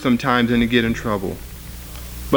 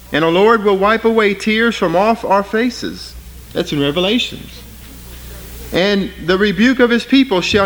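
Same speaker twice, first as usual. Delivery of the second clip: raised, ringing scripture reading; preaching scripture; 5 semitones higher than usual. Acted. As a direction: lift the verse up over the congregation. Reads Isaiah passage aloud.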